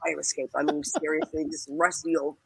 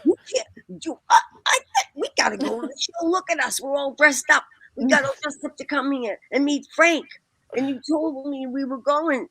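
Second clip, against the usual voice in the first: pleading protest; 7 semitones higher than usual. Retold or imitated. To the doorman at the sold-out show, high, fast pleading. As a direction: talk him into letting you in.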